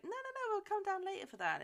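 high, quick waving-away of an offer; acted brush-off. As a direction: imitate a daughter hurriedly declining. Daughter waving off offer, retold.

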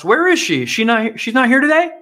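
put-on lost old politician scanning the room; mocking Biden asking for a dead congresswoman; imitating Biden. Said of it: "he still asked".

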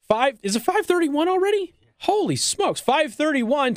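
real surprise at the clock; surprised. Real, not acted. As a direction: pitch jumps up in startled disbelief. Notices the clock mid-show.

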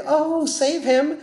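high, drawn-out cooing plea; mock tender pleading. Voices an imagined sentimental character.

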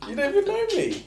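high, squeaky laughing protest. Real, not acted. Storyteller protesting the colleague's jibe, laughing high. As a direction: squeeze the words out through laughter.

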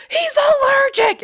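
high, loud, drawn-out mock screech; mock yelling. Retelling her outburst about his allergy as a high mock yell. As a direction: imitate her yelling, stretching the words out. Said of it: "after she's yelling".

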